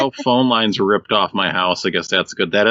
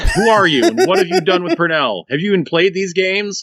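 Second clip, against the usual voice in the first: hammed-up shock, pitched up; mock disbelief; 8 semitones higher than usual. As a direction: playfully accuse an impostor. Mock shock at friend's behaviour.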